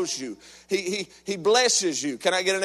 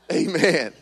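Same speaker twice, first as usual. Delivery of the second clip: ordinary talk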